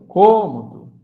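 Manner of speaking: "cômodo" with a loud, closed CÔ; stress on CÔ. Shown as a demonstration. Teacher calling out the word's closed sound, loud stressed CÔ in "cômodo". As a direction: punch the closed first vowel of "cômodo". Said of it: "então som fechado".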